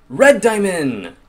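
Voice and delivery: loud, slow cry, high then falling; excited cry. Real, not acted. Player discovers red ore, excited cry. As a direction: draw it out loudly with glee. Finds redstone in game.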